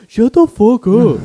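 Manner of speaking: high, loud, drawn-out mock yell; retold angry outburst. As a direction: imitate a fed-up classmate yelling. Retold outburst of annoyed classmate.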